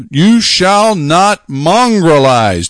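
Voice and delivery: very slow, proclaiming "You shall not"; solemn proclamation. Acted. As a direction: intone it like scripture read aloud.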